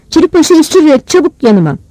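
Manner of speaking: high urgent shout; shouted order. A narrator's reading. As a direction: call out an impatient command from afar. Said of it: "diye bağırdı Irazcı".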